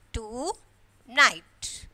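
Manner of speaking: "Tonight" as "to", long pause, "night"; separated syllables. Shown as a demonstration. Teacher asking how to separate this, "Tonight" split slowly. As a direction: stretch and split "Tonight" in two. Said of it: "separate this".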